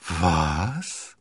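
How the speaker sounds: queen acting very surprised; feigned astonishment. A narrator's reading. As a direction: ask with a rising, mock-astonished swoop. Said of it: "rief die Königin und tat sehr erstaunt".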